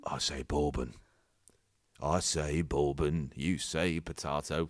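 low, slow Cockney voice; deliberate, deep Cockney verdict; hammed-up Cockney dragon; Cockney investor. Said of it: "Oh, he's Cockney".

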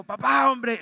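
an angry, loud outburst at dad. Retold or imitated. Teen yells at his father after the slap, loudly. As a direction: shout it at your father, fed up. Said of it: "le levantó la voz".

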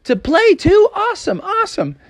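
high, thrilled little frog voice; excited hollering. Acted. Froggy greets his friends at the rec center, thrilled. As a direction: holler happily in a high kid voice. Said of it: "waving and hollering at them".

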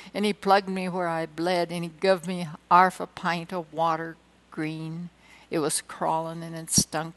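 rhythmic ballad recitation with soldier swagger; poem recitation. Acted. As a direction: declaim the barrack-room ballad. Recites Gunga Din like dad.

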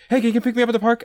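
put-on high, loud child's plea; little kid voice. Kid calling mom collect from the park, high and loud. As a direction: squeak it out like a kid on a payphone. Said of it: "they'd be like".

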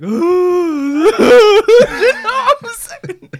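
high, wailing mock of a dumped mate; whiny heartbroken friend. Mimics heartbroken friend whining.

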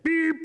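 short high-pitched beep; squeaky little horn beep; truck beep. A narrator's reading. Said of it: "Blue said".

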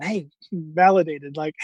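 ordinary talk, nothing marked

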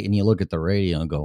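normal talking voice

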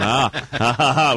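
loud, teasing laugh. Real, not acted. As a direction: laugh out loud at the guest's accent. Host teases guest about accent.

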